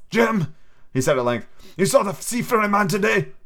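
put-on a sick old sailor coaxing the boy; old pirate captain. Captain asking.

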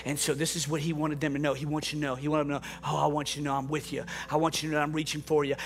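low, quiet, tender preaching; soft closing sermon. Acted. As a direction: close the sermon gently over the music. Soft closing sermon over music.

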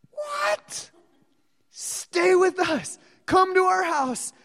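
high pleading voice of astonished disciples; eager disciples. Retold or imitated. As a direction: beg him, wide-eyed, to stay. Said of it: "And they're like".

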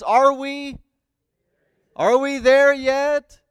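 put-on high, loud, drawn-out child's nagging; whining kid. Kid nagging parents from the back seat, whining. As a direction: stretch it out like an impatient kid. Famous kid question on trip.

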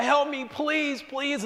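high, pained mock plea, slow; imitating a desperate cry for help; frantic plea. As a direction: beg as a panicked mother. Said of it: "she's freaking out".

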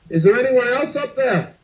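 high, loud comic shout. Retold or imitated. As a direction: yell it up, hoping for someone else. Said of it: "he shouts up".

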